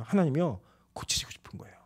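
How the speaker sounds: intimate whisper; soft whispered confession. Real, not acted. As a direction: breathe it to the congregation. Whispered climax.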